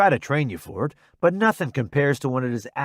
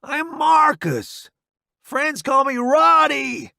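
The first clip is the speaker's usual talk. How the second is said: high slow cheerful yell; shouting; 12 semitones higher, 4 dB louder, slower than usual. Acted. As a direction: holler it over explosions. Yelling over the bombs.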